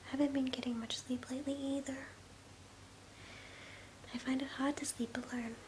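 ordinary talk, nothing marked

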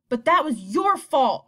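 a son accusing his mother through clenched teeth. Acted. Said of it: "through clenched teeth".

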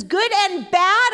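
high, loud, slow imitated question; incredulous mishearing. Mother mishears daughter's book title, incredulous. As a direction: stretch it out in hammed-up disbelief.

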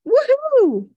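high, loud, drawn-out cheer; joyful whoop. Retold or imitated. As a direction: whoop like a father thrilled to be off. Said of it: "I heard my father's voice going".

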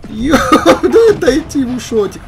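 high, loud laughing plea; real laughter, loud and high. Real, not acted. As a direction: laugh it out, almost shrieking. Laughs at enemy tank.

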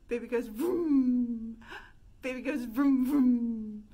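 loud buzzing lip vroom; revving vroom. Shown as a demonstration. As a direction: rev it up like an engine. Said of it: "make the vroom noise".

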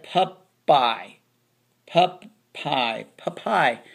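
"pup pie", final y said like eye; wrong Y sound. Shown as a demonstration. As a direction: misread puppy to rhyme with "pie". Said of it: "No, it's pup E".

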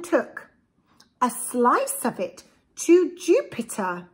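lively, sing-song storybook read; reading to children. A narrator's reading. Parent reading a picture book to a child, lively storybook voice. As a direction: read it aloud to little ones with relish.

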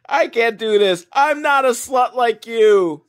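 high, loud imitation of a quarrel; mock upset accusation. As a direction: fling the insult, hammed-up. Acted quarrel between two women.

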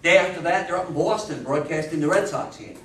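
ordinary talk

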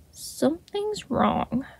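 very soft, slow, worried murmur; murmured quietly. A narrator's reading. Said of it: "he murmured quietly".

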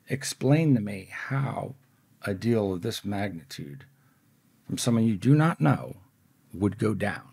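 soft, measured, pointed questioning; deliberate challenge. Retold or imitated. Skeptic tests a professional diamond dealer, quietly and deliberately. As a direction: lay it out phrase by phrase, with pauses.

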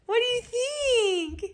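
high, slow, near-tearful coo. Real, not acted. Mom moved by the gift, gushing at her toddler in high baby talk. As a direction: gush over the little one, almost welling up.

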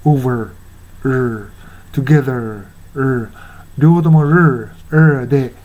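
"over", "together" ending in a rhotic "er"; American r. Shown as a demonstration. Teacher showing normal American English, r sounded at the ends. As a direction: curl the final "er" of "over" and "together" into a clear r. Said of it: "普通のアメリカ英語だったら".

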